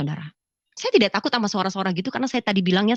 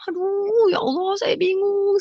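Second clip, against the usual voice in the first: high, slow, drawn-out wail; retold bewilderment; 10 semitones higher, slower than usual. Woman baffled by tiny print, high drawn-out lament. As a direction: act out lost confusion, stretching the words. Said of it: "bingung saya".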